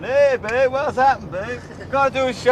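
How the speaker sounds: breezy cool-cat greeting; imitating the guys. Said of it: "all going".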